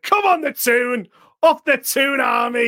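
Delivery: loud, slow football chant; cheering shout. Retold or imitated. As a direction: roar it like a fan at the match. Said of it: "I was like".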